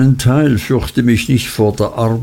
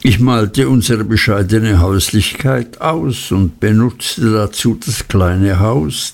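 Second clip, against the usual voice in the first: ordinary delivery, nothing marked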